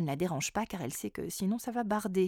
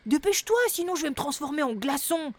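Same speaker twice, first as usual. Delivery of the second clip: Boubou pressé; 5 semitones higher, 3 dB louder than usual. Acted.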